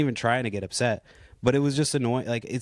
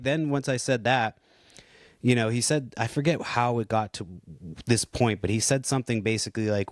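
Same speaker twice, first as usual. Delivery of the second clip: ordinary delivery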